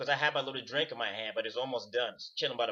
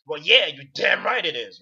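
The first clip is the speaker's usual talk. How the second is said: loud retort; 9 dB louder than usual. Retold or imitated. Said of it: "My response is more like".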